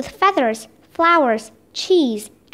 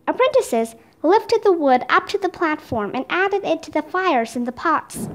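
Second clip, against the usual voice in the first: plain reading voice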